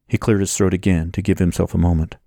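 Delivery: ordinary delivery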